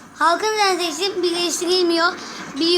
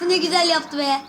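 ordinary talk, nothing marked

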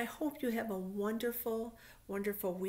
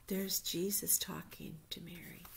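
whisper. Real, not acted. Pointing at picture for kids.

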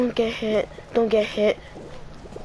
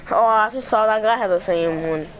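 ordinary talk

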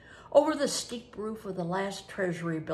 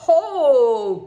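a sudden loud ho, higher and louder; loud cry; 7 semitones higher, 14 dB louder than usual. Acted. Adam crying out to Perkin, loud teasing ho. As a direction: burst out with a hearty ho. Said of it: "cried Adam".

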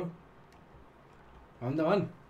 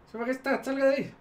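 urgent shout; 12 semitones higher, 3 dB louder, faster than usual. Real, not acted. Queen still under attack.

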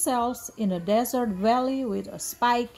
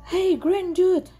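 high, loud, out-of-breath call; winded from the chase; panting; 7 semitones higher, 5 dB louder than usual. A narrator's reading. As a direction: call out between heavy breaths. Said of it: "panted Tom".

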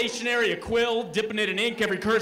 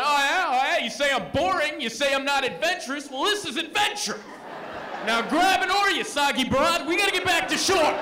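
mock yelling back; 9 semitones higher than usual. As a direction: yell back at her over the rain. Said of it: "yelling back at her".

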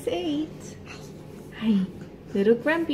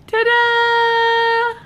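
high, bright reveal; excited ta-da; 13 semitones higher, 5 dB louder than usual. Acted. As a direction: present it like a magic trick. Showing the baby.

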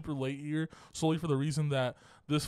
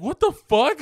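retold disbelief; 11 semitones higher, 10 dB louder, slower than usual. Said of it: "I'm like".

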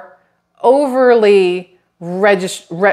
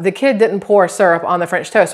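ordinary delivery

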